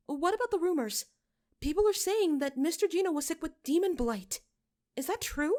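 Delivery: a frightened parishioner pressing for the truth; nervous young woman. A narrator's reading. Midge questions the shepherd.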